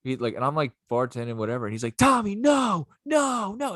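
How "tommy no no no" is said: high-pitched alarmed cry; frantic mock shout. Imitating customer warning the bartender, frantic high shout. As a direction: cry out to stop him, voice way up.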